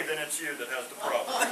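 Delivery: ordinary talk, nothing marked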